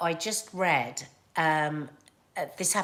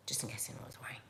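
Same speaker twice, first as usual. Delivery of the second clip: whispering; 9 semitones lower, 16 dB quieter, much faster than usual. Real, not acted. Whispered joke aside.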